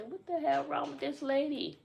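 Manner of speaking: high-pitched incredulous retort; mock indignation. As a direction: act out an affronted double take. Acting out her startled retort.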